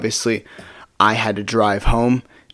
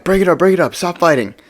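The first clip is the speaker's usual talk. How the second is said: calling out; 7 semitones higher than usual. Retold or imitated. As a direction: imitate bystanders stopping a fight, pitched up. Kids breaking up a retold fight.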